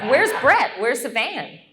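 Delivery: sudden worried shout; alarmed asking. Retold or imitated. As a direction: call out in alarm. Panicked asking where the van is.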